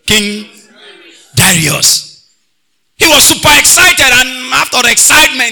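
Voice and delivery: loud, high, drawn-out preaching; preaching at full force. Acted. Pastor preaching to the choir about anger, at full force. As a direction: preach it over the music to the church.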